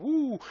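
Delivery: high overwhelmed woo, mock flustered. Coach imitating an overwhelmed newcomer: high mock woo. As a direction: play the swamped listener with a squeaky woo. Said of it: "it's just going".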